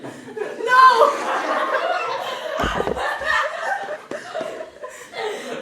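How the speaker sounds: very high, loud burst of laughter; shrieking laugh. Real, not acted. Artist losing it over the slipped accent, shrieking with laughter. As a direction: cackle helplessly. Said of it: "losing grip on myself".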